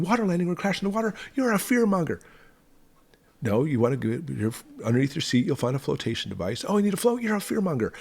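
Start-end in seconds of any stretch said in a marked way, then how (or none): 0.0-2.2 high, sneering mock accusation; mocking accuser. Mocking the critics in a high whiny voice. 6.7-7.9 high, sneering mock accusation; mocking accuser. Mocking the critics in a high whiny voice.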